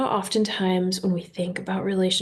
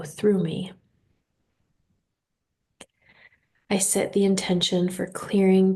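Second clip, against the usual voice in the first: guided meditation. Real, not acted. Host leading listeners through breathing and intentions, guided meditation. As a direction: guide them unhurriedly into relaxation.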